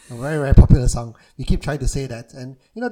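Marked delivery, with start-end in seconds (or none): 0.0-1.2 laughing. Real, not acted. Laughing while recalling the song.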